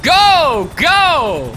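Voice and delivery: loud, high urgent yell; urgent shout. Real, not acted. Urging his friends to flee, loud and urgent. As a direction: bark it out in a panic.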